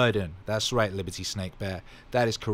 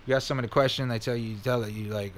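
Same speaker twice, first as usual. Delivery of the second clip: ordinary delivery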